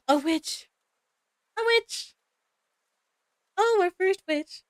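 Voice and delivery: high-pitched, thrilled exclamations; excited gasp. Real, not acted. Streamer spots the game's first witch, thrilled and high. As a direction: squeal with delight at the reveal.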